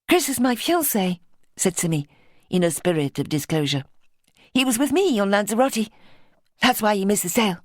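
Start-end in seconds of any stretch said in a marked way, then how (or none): 0.1-1.2 lightly voiced young woman, higher; a hint of Simmy, a touch higher; Simmy's voice. Simmy telling Beverly about her fiancé, lightly higher. 4.5-7.6 lightly voiced young woman, higher; a hint of Simmy, a touch higher; Simmy's voice. Simmy telling Beverly about her fiancé, lightly higher.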